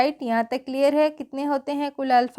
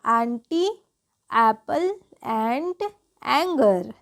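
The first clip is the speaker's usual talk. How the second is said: Apple and anger spelt out slowly, syllable by syllable; slow model words; much slower than usual. Shown as a demonstration. Teacher to class, drawing out the model words slowly. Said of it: "तीन तरीके से होता है".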